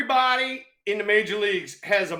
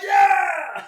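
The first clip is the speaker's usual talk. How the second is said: high, loud yell of triumph; triumphant yell; 9 semitones higher, 4 dB louder than usual. Retold or imitated. Retelling his homer to teammates, loud triumphant yell. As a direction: crow it out like you just homered.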